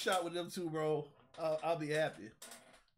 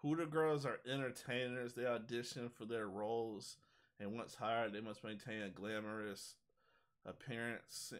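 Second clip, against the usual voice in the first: formal read; 6 semitones lower, 6 dB quieter than usual. Acted. Reading official Hooters description.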